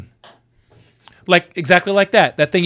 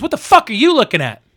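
raised-pitch imitation of an angry stranger; angry challenge; 7 semitones higher than usual. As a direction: confront someone as the mad passerby would. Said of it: "Just angry".

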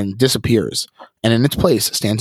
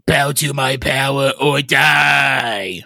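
hissing threat; 4 semitones higher, much slower than usual. Acted. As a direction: threaten with slow menace. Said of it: "the figure hisses".

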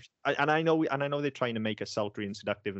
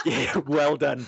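sarcastic; 5 semitones higher, 6 dB louder, much slower than usual. Retold or imitated. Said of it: "I was literally like".

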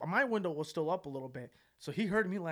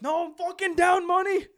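put-on high, loud drunken complaint; mock-drunk indignant yell; drunk Australian roommate; 9 dB louder than usual. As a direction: whine it loudly as a drunk gambler. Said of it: "he was pissed drunk".

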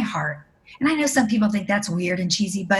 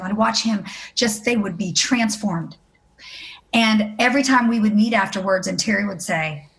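ordinary delivery

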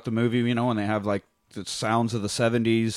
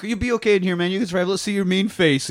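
higher, coaxing tough-guy pep; encouraging inmates; 8 semitones higher, 4 dB louder than usual. Retold or imitated. Inmates cheering on Kenny in jail, high and coaxing. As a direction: egg him on brightly. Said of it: "They're like".

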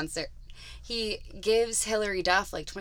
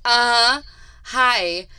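put-on snarky teen; 4 semitones higher, 10 dB louder, much slower than usual. Teen greeting mom's dates coldly.

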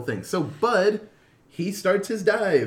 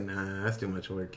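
low and much softer, lazy; lazy brush-off; 8 semitones lower, 10 dB quieter than usual. Retold or imitated. Retelling humans refusing aliens, soft lazy brush-off. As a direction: shrug it off, lazily. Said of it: "He's like".

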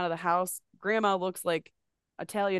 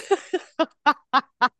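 high burst of laughter; loud laugh; 8 semitones higher, 10 dB louder than usual. Real, not acted. Laugh after retold panic.

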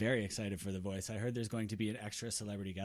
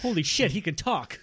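high, loud cry of disbelief; shocked outburst; 9 semitones higher, 10 dB louder than usual. Acted. Son discovering the tortoise can talk, loudly astonished. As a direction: burst out in astonishment.

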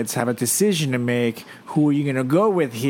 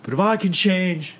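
speechmaker voice; 4 semitones higher, 3 dB louder than usual. Retold or imitated. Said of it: "It's like".

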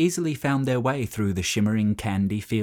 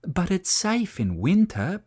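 put-on higher-pitched childlike plea; pleading child; 9 semitones higher than usual. A narrator's reading. Children beg their parents to allow the climb, pleading. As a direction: plead like a child wanting permission. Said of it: "pleaded the children".

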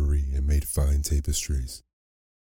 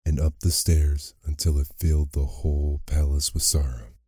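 usual speaking voice

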